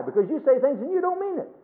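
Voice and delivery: mock defiant child. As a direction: play the kid who calls the bluff. Still in the child's voice.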